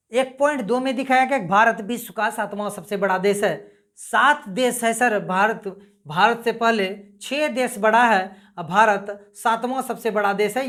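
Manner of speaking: ordinary talk, nothing marked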